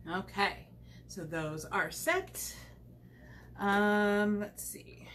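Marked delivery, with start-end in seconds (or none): 4.4-5.1 whisper. Real, not acted. Muttering while working.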